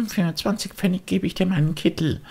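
put-on high, longing puppet boy; a boy pulled toward the music; wistful high boyish voice; Bengele's voice. Bengele offers his coat.

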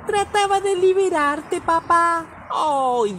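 high, small kitten voice. A narrator's reading. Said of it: "dijo el gatito".

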